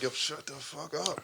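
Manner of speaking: slow, hushed angry hiss; hushed scold. Retold or imitated. Mom hushing child in public.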